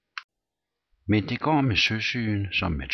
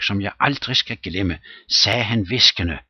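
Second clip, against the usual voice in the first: plain reading voice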